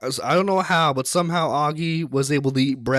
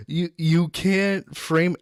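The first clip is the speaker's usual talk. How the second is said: ordinary talk, nothing marked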